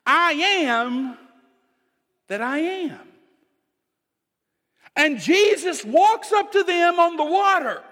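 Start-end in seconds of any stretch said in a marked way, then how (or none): 0.0-3.1 solemn divine name. Acted. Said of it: "it literally means".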